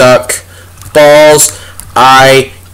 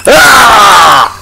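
sharp high yelp of alarm; startled yell; 6 semitones higher, 4 dB louder than usual. Real, not acted. Gamer yelps at a sudden mob. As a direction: cry out as something jumps you.

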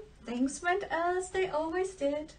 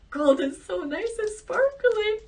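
higher, starry-eyed voice; dreamy daughter; 8 semitones higher, 6 dB louder than usual. Acted. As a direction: gush over the shiny gold. Daughter admires gold.

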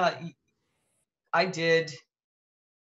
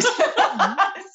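squealing laugh, much higher; high burst of laughter; 5 dB louder than usual. Real, not acted. Laughing at her own joke, high burst of laughter. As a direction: laugh out loud, high and bright.

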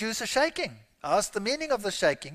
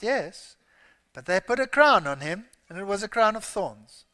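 ordinary talk

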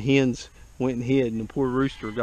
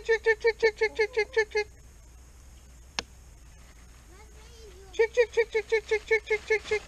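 chicken call. Real, not acted.

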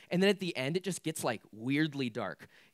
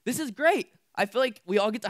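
much higher and louder burst of enthusiasm; excited; 7 semitones higher, 7 dB louder than usual. Real, not acted. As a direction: pop it out with delight. Cheering the permission to be angry.

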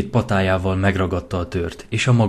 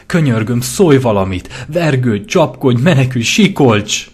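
raised, louder desperate begging; desperate pleading; 5 semitones higher, 7 dB louder than usual. Acted. Lyra begs Pearl to scream, raised and desperate. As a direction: beg her to fight back.

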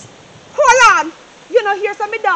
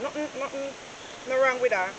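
much softer, lower brush-off; quiet aside; 8 semitones lower, 11 dB quieter than usual. Acted. Mother hushing Jeremiah's worry while her phone rings, quietly. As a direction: mutter it away under the ringing.

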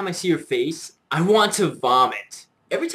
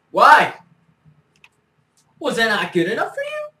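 a sudden loud, higher "why"; angry shout; 6 semitones higher, 5 dB louder than usual. Acted. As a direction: bark the question at them. Angry rant at the listener.

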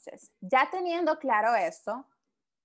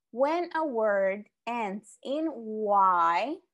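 When a word ends in Y, read slowly and clearly; slow reading aloud; much slower than usual. Shown as a demonstration. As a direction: read the rule slowly for learners. (lee la regla despacio y con claridad)

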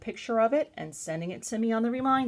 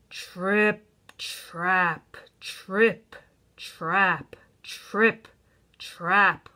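weighty, separated stomps; heavy footsteps; 3 dB louder, much slower than usual. A narrator's reading. Narrator voicing the big goat crossing, heavy tread. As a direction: land each word like a heavy hoof. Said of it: "the Billy Goat was so heavy".

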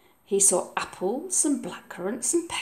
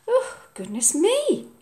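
mock-amazed mum; 10 semitones higher than usual. Mum's voice.

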